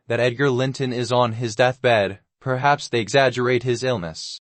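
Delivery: plain reading voice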